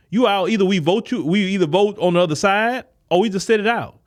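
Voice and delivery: ordinary talk, nothing marked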